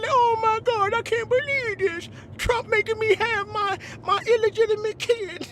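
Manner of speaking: high, whiny fake sob of a rich man; mock crying billionaire. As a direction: play a rich guy wailing about his kid.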